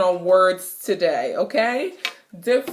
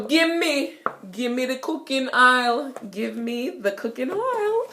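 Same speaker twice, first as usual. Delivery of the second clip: Jamaican patois; 4 semitones higher than usual. Shown as a demonstration. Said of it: "aisle is for oil".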